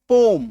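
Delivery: पोम in one firm syllable; actual pronunciation. Shown as a demonstration. Teacher repeating the actual pronunciation for students, one-syllable पोम. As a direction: say पोम with a long o straight into m. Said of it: "actual उच्चारण क्या है?".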